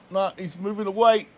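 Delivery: ordinary talk, nothing marked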